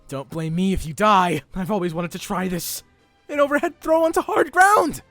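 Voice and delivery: loud, high yell building to a scream; battle shout. Acted. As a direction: shout out the finishing move. Subaru shouts his attack move.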